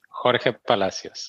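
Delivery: "Jorge Palacios" in clear Spanish, throaty J and G; native Spanish pronunciation. Shown as a demonstration. Guest correcting host on his name, clear native Spanish "Jorge Palacios". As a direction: repeat "Jorge Palacios" distinctly with Spanish sounds. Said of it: "I'll pronounce my name correctly".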